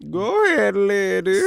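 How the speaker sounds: high, loud cheering; hype-woman cheer; hyped cheer. Real, not acted. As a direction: egg her on, big and bright. Cheering friend on.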